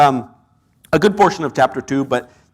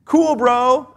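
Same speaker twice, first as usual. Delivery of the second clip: a lightly raised lazy surfer shrug; mock a careless bro, higher; surfer dude; 7 semitones higher, slower than usual. Preacher mocking the careless attitude, lightly voiced bro. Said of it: "I can't go to bed and just say".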